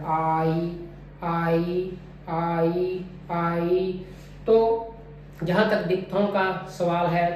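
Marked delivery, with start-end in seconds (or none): 0.1-4.1 आई with a long "aa" easing into a lighter "ee"; longer first sound. Shown as a demonstration. Teacher showing first sound is longer, drawn-out आई. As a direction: hold the start of आई, then glide closed. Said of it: "first sound, eyes longer and louder".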